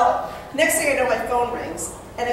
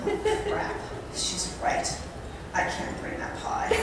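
softer, whispered, defeated; whispered realization; 4 semitones higher, 10 dB quieter than usual. Real, not acted. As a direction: whisper it as it sinks in. Sudden realization about the pie.

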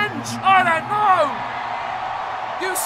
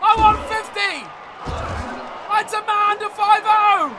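higher and louder, demanding; frustrated shouting; 6 semitones higher, 6 dB louder than usual. Real, not acted. Demanding a fifty score.

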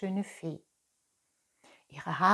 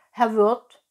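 loud fox calling for service; 2 semitones higher, 10 dB louder than usual. A narrator's reading. Fox calls the innkeeper over, calling loudly. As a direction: call the landlord over. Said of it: "rief der Fuchs".

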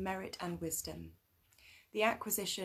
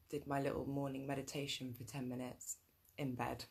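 ordinary talk, nothing marked

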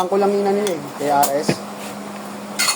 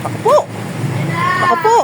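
high-pitched call; 11 semitones higher, much faster than usual. Real, not acted. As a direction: sing out high, playful. High playful call in rain.

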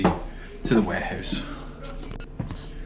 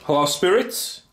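high, raised questioning call; calling out to spirits; 9 semitones higher than usual. Real, not acted. Investigator asks if anyone is here, calling out. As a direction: call into an empty building, pitched well up.

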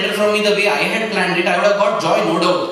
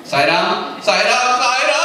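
mounting alarm; 3 semitones higher, slower than usual. Acted. Devotee falling from a hill, chant rising in mock panic. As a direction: let the holy name rise into a mock panicked cry. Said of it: "imagine we are thrown from the hill".

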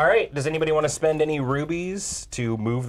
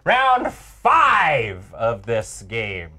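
dramatic announcer; 3 semitones lower, 5 dB louder, much slower than usual. Acted. Dramatic host announcing round.

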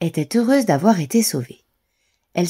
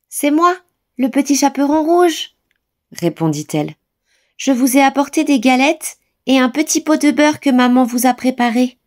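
put-on little girl voice; 7 semitones higher, 4 dB louder than usual. A narrator's reading. The girl answers grandmother.

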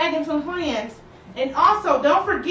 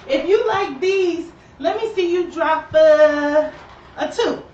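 hype call; 3 semitones higher, 6 dB louder, slower than usual. Real, not acted. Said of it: "hype call to viewers".

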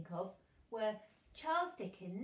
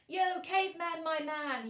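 big hearty call, much higher and louder; 7 semitones higher, 9 dB louder than usual. A narrator's reading. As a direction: holler to a pal across the room. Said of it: "he called out".

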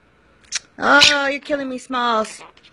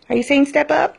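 usual speaking voice

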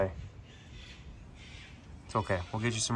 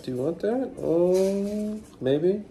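much higher, sing-song coaxing to a bird; high pet talk; 10 semitones higher, 4 dB louder, slower than usual. Real, not acted.

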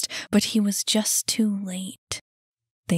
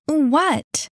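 high, loud, falling challenge; indignant What; 7 semitones higher, 6 dB louder than usual. Acted. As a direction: demand to know the joke. Annie reacts to his laughter.

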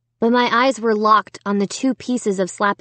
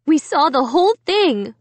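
mom exclaiming in a higher voice; excited exclamation; 6 semitones higher than usual. A narrator's reading. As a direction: blurt it out, pitch leaping up. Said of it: "Mom exclaimed".

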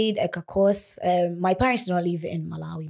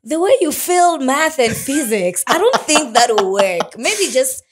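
higher, louder mom's discouraging verdict; 6 semitones higher, 5 dB louder than usual. Retold or imitated. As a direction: voice a mother talking down an ambition, pitched up. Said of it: "but my mom was like".

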